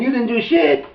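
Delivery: high sneering jab; smartass taunt. Retold or imitated. Deputy taunting the shot man, high smartass sneer. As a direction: toss it off like a smartass. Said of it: "like a smartass".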